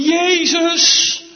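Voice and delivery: high, loud cry of the name; calling out. Acted. Blind beggar crying out to Jesus by the road, loud. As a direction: call his name across the crowd. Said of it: "dat je gaat roepen".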